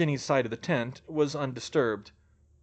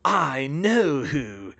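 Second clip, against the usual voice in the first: very slow, drawn-out villain gloating; smug dark lord, stretched out; 4 dB louder, much slower than usual. A narrator's reading. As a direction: savour each word smugly. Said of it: "You-Know-Who said smugly".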